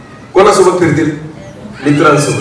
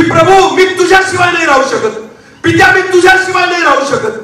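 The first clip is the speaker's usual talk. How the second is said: high-pitched pleading to the Father; preacher voicing a desperate prayer; acted plea; 12 semitones higher than usual. Jesus' plea voiced by preacher.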